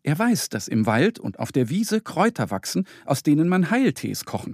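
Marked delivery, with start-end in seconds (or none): none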